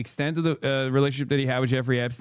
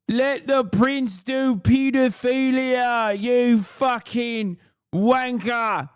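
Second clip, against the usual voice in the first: high shouting British royalist; gammon voice; 8 semitones higher, much slower than usual. Pretend. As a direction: rant like an outraged royalist. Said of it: "Look at this fucking gammon".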